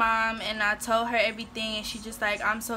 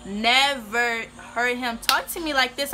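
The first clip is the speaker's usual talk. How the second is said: ordinary talk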